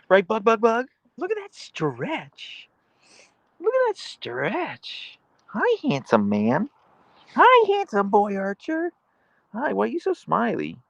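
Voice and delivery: very high, slow, cooing pet voice. Real, not acted. Owner cooing at her husky, very high and slow. Baby talk to dogs.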